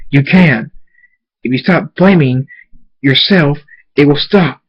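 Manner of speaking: emphatic, urgent reassurance; firm urging. Acted. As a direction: press each phrase home with force. Father urges his tormented son.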